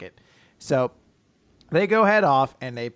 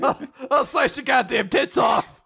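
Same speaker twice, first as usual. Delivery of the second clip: laughing mock threat; 8 semitones higher than usual. Topping the joke about Asumi's secret rage, laughing mock shout. Continuing the mock angry retort.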